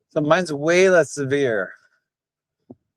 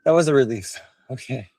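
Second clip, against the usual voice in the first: ordinary talk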